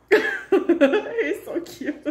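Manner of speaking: slow, high giggling gush; laughing. Real, not acted. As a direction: giggle through the words. Laughing while gushing.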